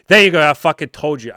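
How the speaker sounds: loud, high gloating outburst; shouting I told you so; triumphant shout. Real, not acted. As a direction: yell it like you just won the bet.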